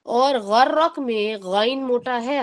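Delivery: ordinary talk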